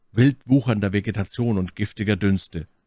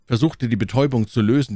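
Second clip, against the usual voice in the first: usual speaking voice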